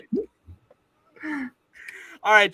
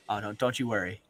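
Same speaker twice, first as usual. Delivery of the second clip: ordinary delivery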